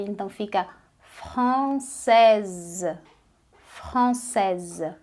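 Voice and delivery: française, the final s voiced as z; française with the S sounded. Shown as a demonstration. Teacher showing students the added z sound, slow. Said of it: "Quando a gente adiciona o S".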